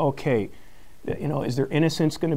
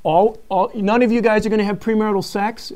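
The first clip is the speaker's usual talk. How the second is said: mocking; 9 semitones higher, 6 dB louder than usual. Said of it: "Kinda, you know, almost mockingly".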